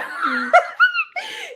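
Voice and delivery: very high, loud shriek of laughter. Real, not acted. Host laughing at the idea they might die, shrieking laugh.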